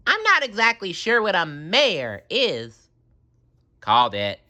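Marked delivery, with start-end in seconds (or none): 0.1-2.9 high, naive cartoon voice; cheerfully clueless; SpongeBob voice. A narrator's reading. SpongeBob speaking.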